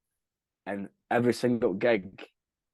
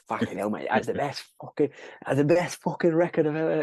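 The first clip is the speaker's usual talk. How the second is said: put-on Mikey Jones Cockney accent; 5 semitones higher than usual. Band member imitating the promoter's phone call, in a put-on Cockney accent. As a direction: do a gushing London geezer's Cockney praise. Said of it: "in his Cockney fucking accent".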